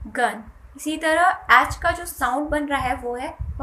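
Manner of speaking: ordinary talk, nothing marked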